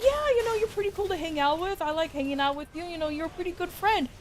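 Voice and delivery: high, oblivious and cheerful; clueless himbo voice. Retold or imitated. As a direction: play the clueless friend happily agreeing. Himbo misreading a confession.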